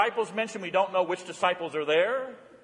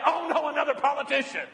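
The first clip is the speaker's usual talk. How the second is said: higher, exasperated groan; mock groan; 6 semitones higher than usual. Shown as a demonstration. Preacher showing how we react to politicians, exasperated. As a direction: sigh it out like a bored TV viewer. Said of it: "to say".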